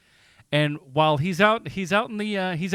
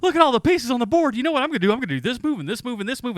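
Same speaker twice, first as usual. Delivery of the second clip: high, loud, rushing excitement; gleefully plotting chess moves, faster at the end; excited inner voice; 8 semitones higher, 3 dB louder, faster than usual. Retold or imitated. Host imitating his own brain playing chess, excitedly. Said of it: "I'm just going".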